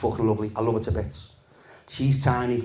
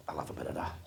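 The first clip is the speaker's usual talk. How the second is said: hushed aside; 10 semitones lower, 15 dB quieter, much faster than usual. Retold or imitated. Tempted thought in dark entry.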